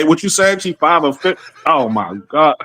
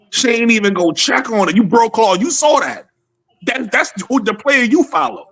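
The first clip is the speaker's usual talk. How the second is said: heated; 7 semitones higher than usual. Real, not acted. Heated rant about the player.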